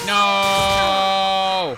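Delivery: loud frustrated shout. Real, not acted. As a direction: yell "No!" as things go wrong. Game character staggered.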